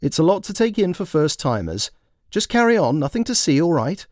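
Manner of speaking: calm the crowd with firm authority; Sue commanding. Acted. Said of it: "Sue commanding".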